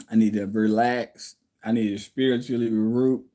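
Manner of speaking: ordinary talk, nothing marked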